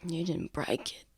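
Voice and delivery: soft, under-the-breath retort; quiet objection. Acted. Injured Lucian corrects Tav, quiet murmur.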